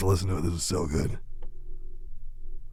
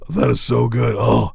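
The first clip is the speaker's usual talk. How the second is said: usual speaking voice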